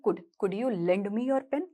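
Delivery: soft, slow, polite asking; polite request. Shown as a demonstration. Teacher showing a polite request, soft and slow. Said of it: "polite वे में".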